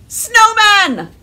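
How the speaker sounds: loud high excited reveal. Acted. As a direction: announce it with big excitement. Big reveal of title.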